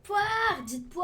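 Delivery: loud eager call; shouting. Real, not acted. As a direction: shout it out to chat. Shouting team choice for chat.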